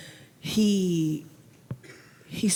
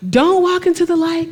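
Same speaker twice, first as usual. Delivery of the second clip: urgent warning; 10 semitones higher, 6 dB louder than usual. Real, not acted.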